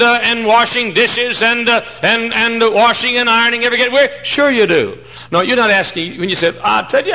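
high, loud, pounding questions to the crowd; preaching at full force. Acted. Preacher asking mothers if they get weary, preaching at full force. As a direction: hammer each question home from the pulpit.

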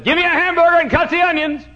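high, loud counter order; preacher booming his old hamburger order. Retold or imitated. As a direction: call the order out confidently. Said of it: "And I said".

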